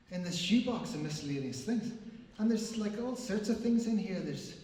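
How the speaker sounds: ordinary talk, nothing marked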